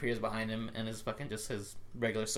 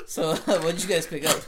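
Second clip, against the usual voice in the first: raised voice breaking into laughter; laughing question; 5 semitones higher, 8 dB louder than usual. Real, not acted. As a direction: ask while cracking up, loudly. Host cracking up while asking.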